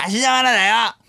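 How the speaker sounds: put-on very high, crude child-star caricature; 芦田愛菜. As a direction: squeak it out as a fake little girl. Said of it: "芦田愛菜ちゃんやってよ、". (芦田愛菜のものまねのものまね、甲高く)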